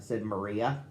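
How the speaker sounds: ordinary delivery, nothing marked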